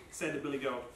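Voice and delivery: usual speaking voice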